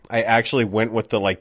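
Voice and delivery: usual speaking voice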